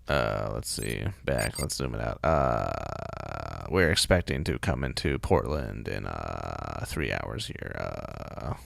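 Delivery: low, calm cockpit announcement voice; deep pilot on the intercom; airplane pilot voice. Pretend. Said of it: "indescript airplane pilot voice".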